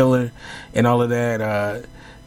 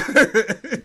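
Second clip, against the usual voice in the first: laughing; 12 semitones higher, 4 dB louder than usual. Real, not acted. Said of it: "Like Colonel Sanders and shit".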